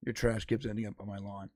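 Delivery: very low, muted neighbor voice; low, understated needling complaint; passive-aggressive neighbor. Retold or imitated. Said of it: "he does this passive aggressive thing".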